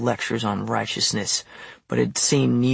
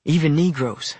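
higher, self-satisfied addition; lofty afterthought; magnanimous; 4 semitones higher than usual. A narrator's reading. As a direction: tack it on as a grand concession. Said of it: "I added magnanimously".

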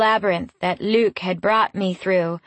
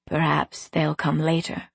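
lightly voiced man, lower in pitch; male companion; 4 semitones lower, 3 dB quieter than usual. A narrator's reading. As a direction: answer her in a slightly lowered male voice. Male companion answers her.

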